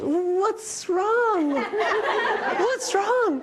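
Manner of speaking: high, urgent retold what's wrong; alarmed calling out. Running outside to the screaming neighbor, high alarmed call. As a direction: call out anxiously to a distressed neighbor. Teller asking again.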